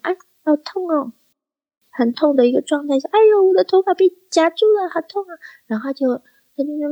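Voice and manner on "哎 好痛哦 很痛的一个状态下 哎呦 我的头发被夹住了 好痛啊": high, sweet celebrity whimper over a pulled hair; Lin Chi-ling voice. Retold or imitated. Imitating Lin Chi-ling hurt by a hairdresser, high sweet voice. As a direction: play the dainty star saying ouch in a much higher voice. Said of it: "如果林志玲讲话这个样子".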